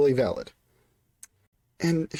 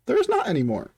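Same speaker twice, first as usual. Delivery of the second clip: ordinary delivery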